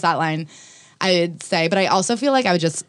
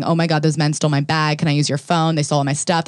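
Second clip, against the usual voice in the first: ordinary talk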